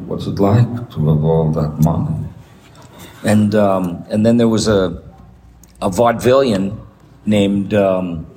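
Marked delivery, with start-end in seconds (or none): 0.0-2.2 lightly voiced low old Yiddish actor; a hint of a low old Yiddish comic; Lou Jacoby voice. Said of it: "leaned into Dudley Moore and went".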